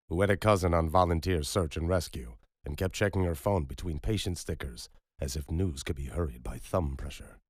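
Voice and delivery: ordinary delivery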